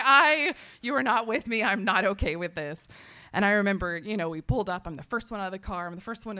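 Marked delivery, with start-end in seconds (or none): 0.0-2.8 loud, put-out protest; retold indignant refusal. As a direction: push back firmly at a husband. Retold anger at husband.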